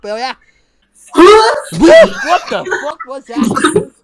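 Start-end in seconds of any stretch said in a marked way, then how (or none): 1.1-3.9 loud, high, drawn-out cry; startled outburst. Real, not acted. Prankster reacting to a stranger's noise, startled shout. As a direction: blurt it out slowly in disbelief.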